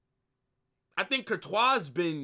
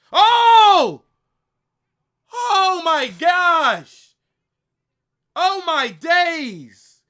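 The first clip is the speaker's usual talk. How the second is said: loud, slow, high gasping cry; shocked shouting; 12 semitones higher, 14 dB louder, much slower than usual. Real, not acted. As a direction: shout it out in disbelief. Big chance reaction in match.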